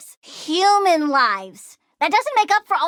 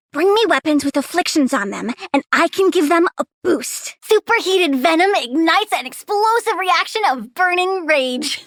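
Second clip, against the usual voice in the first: ordinary delivery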